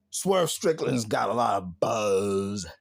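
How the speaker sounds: a hint of a halting wrestling promo; flat, stalled repeat of the line; imitating Moxley. Mimicking Moxley's stumbling promo line, halting.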